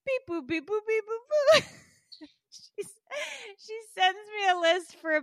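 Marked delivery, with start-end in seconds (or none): 0.1-1.6 high-pitched singsong beeps, climbing higher; imitated computer beeping. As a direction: mimic a machine processing in a high voice. Imitates phone system working.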